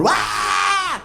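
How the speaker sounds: very high screaming yell; rock-star scream. Retold or imitated. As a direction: let out a wild metal shriek. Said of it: "he was gonna come into the store and go".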